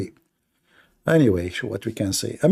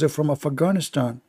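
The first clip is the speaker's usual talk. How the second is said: ordinary delivery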